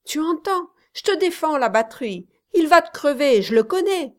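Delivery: high, loud pleading cries; crying out. Acted. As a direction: shout at him to stop, pleading.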